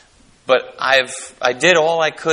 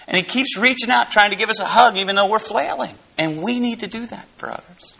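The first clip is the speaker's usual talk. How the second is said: higher, louder preacher's build; soaring pulpit delivery, much higher and louder; fired-up preaching; 8 semitones higher, 3 dB louder than usual. Acted. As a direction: preach it hard to the congregation. Preaching at full force.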